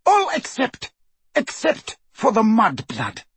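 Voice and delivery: high, cutting exception; single one out sharply. A narrator's reading. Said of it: "said Bellatrix sharply".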